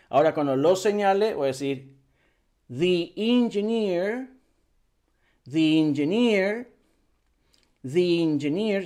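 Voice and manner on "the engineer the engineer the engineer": "the engineer" slowly, "the" as thee gliding into the vowel; linked "thee". Shown as a demonstration. Teacher models linking before a vowel, thee engineer. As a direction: join "the" to "engineer" with an ee. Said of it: "link de the".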